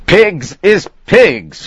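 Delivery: high, slow and firm; firmly. Acted. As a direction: lay it down as final. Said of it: "Flannery said firmly".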